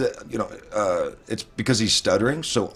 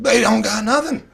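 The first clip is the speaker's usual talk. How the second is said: emphatic exclaim; 10 semitones higher, 4 dB louder than usual. Real, not acted. Emphatic agreement, very high.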